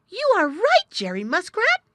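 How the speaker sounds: high, loud animal exclamation; excited cry. A narrator's reading. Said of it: "he cried".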